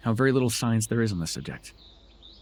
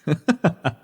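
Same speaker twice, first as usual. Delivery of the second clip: short, high, loud burst of laughter; amused laugh; 7 semitones higher, 5 dB louder than usual. Acted. Amused at listener's refusal to enter.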